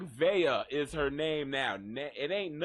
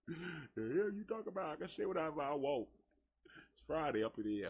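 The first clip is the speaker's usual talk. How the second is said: softer, under the breath; quiet aside; 8 dB quieter, faster than usual. Acted. Defending what they say on Friday, softer aside. Sudden soft voice.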